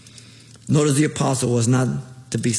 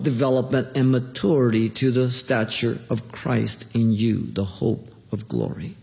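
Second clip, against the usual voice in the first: ordinary delivery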